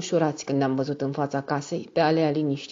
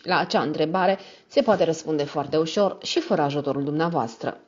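ordinary delivery

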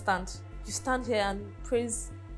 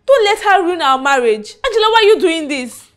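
louder, pleading with both of them; pleading cry; 4 semitones higher, 8 dB louder than usual. Acted. Wife begging husband and mistress, loud pleading cry. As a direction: beg him, then turn on her.

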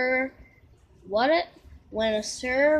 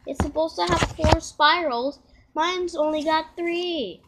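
put-on higher, louder boy's complaint; Nate growling; 6 semitones higher, 4 dB louder than usual. Nate complains about his ice cream swirl, in a higher, louder boy's complaint. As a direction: grumble like a cheated kid, raised. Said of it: "Nate growled".

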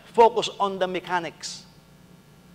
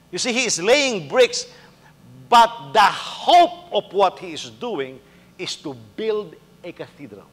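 usual speaking voice

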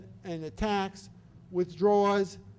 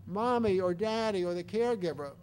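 ordinary talk

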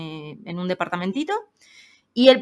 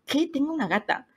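mock disbelief; 8 semitones higher than usual. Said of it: "la veo y digo".

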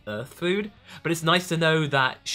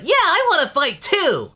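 put-on high, loud eager boy voice; Goku voice; 12 semitones higher, 8 dB louder than usual. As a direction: squeal it like an eager kid. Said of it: "Goku goes like".

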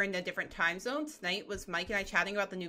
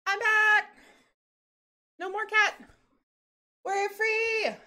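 high, loud gleeful cat voice cheering; cats' triumphant voice; 11 semitones higher, 6 dB louder, slower than usual. Pretend. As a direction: shout it like a freed pet. Cats freed, voiced celebrating.

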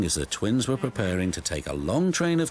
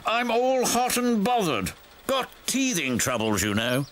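bothered new diesel; 13 semitones higher than usual. A narrator's reading. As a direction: explain your troubles in a high put-on voice. New diesel explains its breakdown.

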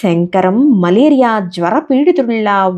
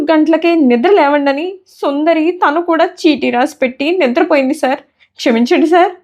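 much higher, meek little voice of a scared clerk; timid pleading clerk; 7 semitones higher than usual. A narrator's reading. Frightened clerk Shankaram begging his angry boss, in a high meek voice. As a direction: whimper out excuses like a frightened underling. Clerk explains, begs forgiveness.

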